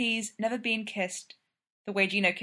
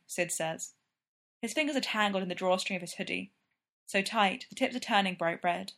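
ordinary delivery, nothing marked

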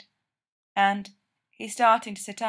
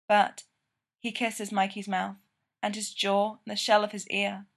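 plain reading voice

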